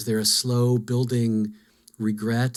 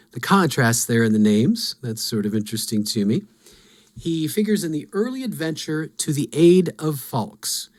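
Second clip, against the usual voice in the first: usual speaking voice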